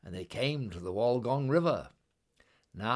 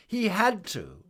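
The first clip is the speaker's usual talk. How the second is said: emphatic refrain; 10 semitones higher, 7 dB louder than usual. A narrator's reading. Narrator's refrain.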